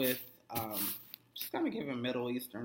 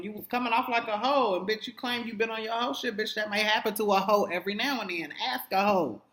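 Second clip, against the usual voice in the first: heated rant; 8 semitones higher, 6 dB louder than usual. Real, not acted. Ranting at the character on screen.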